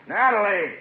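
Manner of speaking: loud, high call across the room. Acted. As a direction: yell her name so she finally hears. Owner calls waitress third time.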